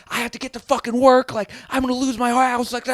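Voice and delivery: high, loud panicked customer voice; frantic caller. Retold or imitated. Stranded driver yelling at the dispatcher, high-pitched panic. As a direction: squeal it like a stressed driver.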